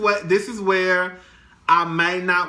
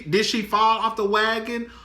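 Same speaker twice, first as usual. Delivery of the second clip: normal talking voice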